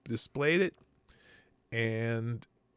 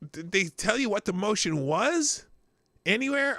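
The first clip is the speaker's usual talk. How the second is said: incredulous; 9 semitones higher, 3 dB louder than usual. Real, not acted. Incredulous question, high.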